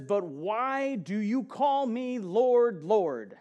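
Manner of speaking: slow, emphatic Bible reading; scripture reading. Acted. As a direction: read it out like holy writ, slowly. Reading scripture, doubled Lord.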